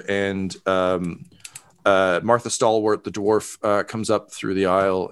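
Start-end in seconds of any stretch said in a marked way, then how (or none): none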